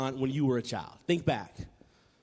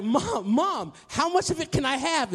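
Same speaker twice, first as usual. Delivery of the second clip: put-on high, eager kid calling Mom; acted boyish excitement, pitched well up; excited child; 8 semitones higher than usual. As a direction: play an eight-year-old bursting to ask.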